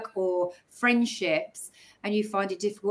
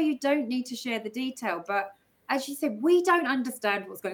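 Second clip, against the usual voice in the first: normal talking voice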